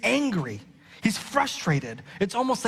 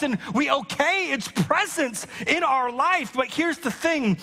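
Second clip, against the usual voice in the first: higher, slowed, driving sermon build; preaching at full force; 7 semitones higher than usual. Acted. Pastor warning the church about sin, preaching at full force. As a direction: preach it hard, hammer each line home.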